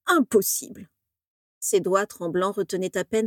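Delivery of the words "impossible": higher, falling, feeble protest; stammering dismay. A narrator's reading. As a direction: falter out a weak denial. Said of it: "bredouilla Slughorn". (bredouillement affolé, voix plus aiguë)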